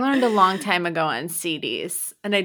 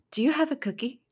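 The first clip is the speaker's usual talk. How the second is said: higher, softer CD translator voice; language CD voice; 6 semitones higher, 4 dB quieter, faster than usual. Retold or imitated. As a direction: translate it like a learning CD. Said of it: "it would be like".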